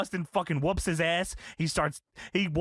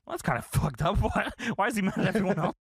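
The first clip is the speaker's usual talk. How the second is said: ordinary delivery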